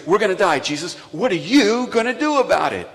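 terrified disciples shouting at Jesus; panicked shout. Acted. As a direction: yell it over the storm. Disciples cry out in storm.

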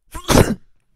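sudden loud sneeze; sneezing. Real, not acted. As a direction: let out one big sneeze. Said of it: "I sneezed".

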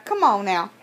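usual speaking voice

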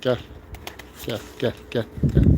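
soft coaxing call to pigeons. Real, not acted.

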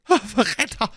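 very high, anguished cry. A narrator's reading. Buckingham stabbed by Felton, high strained scream. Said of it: "schrie Buckingham".

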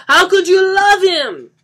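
very high, drawn-out screaming wail; anguished wail. Acted. Johnny asks Lisa about her lover, wailing loudly. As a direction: wail it at the top of your voice. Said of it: "Johnny wailed".

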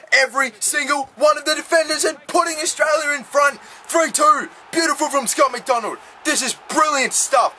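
high, thrilled play-by-play; excited goal call. Real, not acted. Calls his go-ahead goal.

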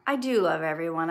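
ordinary talk, nothing marked

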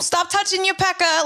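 high, scolding mock Boston accent. Imitating a parent telling off a kid, mock Boston accent. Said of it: "the Boston accent".

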